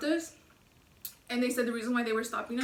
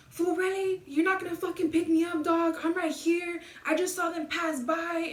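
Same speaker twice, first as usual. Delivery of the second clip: higher-pitched mock griping; indignant complaint; 5 semitones higher than usual. Brother phoning to demand a pickup, acted out as indignant. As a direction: imitate a stranded brother complaining. Retold phone call from stranded brother.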